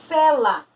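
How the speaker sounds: Cela called out loud and high; announced example. Shown as a demonstration. Teacher announcing a homophone example, emphatic. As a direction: present the word Cela emphatically. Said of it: "Olha aí".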